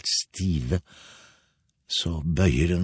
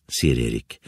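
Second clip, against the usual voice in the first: ordinary delivery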